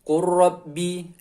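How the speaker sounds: "Qur Rabbi" slowly, l dropped, r doubled; lam merged into ro. Shown as a demonstration. Teacher shows lam vanishing into ro, slow merged recitation. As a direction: run "Qur Rabbi" together on a long rolled r. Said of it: "Langsung ke huruf ro".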